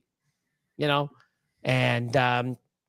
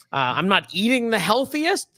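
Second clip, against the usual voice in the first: ordinary talk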